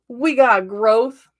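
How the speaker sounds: joyful slow announcement; excited, drawn out. Real, not acted. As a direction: savor each word happily. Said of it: "I'm so excited".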